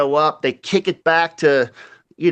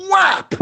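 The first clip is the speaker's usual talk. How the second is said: sharp, very high "Whap!" for the blow; mock whap sound effect. Retelling hitting the drunk guy, whap sound. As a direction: slap the sound out like a hit. Retelling hit with table leg.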